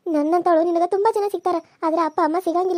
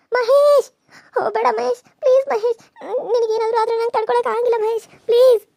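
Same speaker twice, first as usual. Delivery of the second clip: desperate pleading; 3 semitones higher than usual. Acted. Girl pleading with Mahesh not to kill himself. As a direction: beg him not to go.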